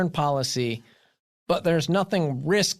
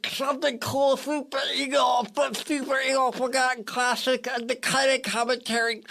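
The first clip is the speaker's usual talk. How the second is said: talking with tongue pinched; clothespin on tongue; 9 semitones higher than usual. Real, not acted. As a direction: speak with your tongue clamped. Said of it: "clothespin on my tongue".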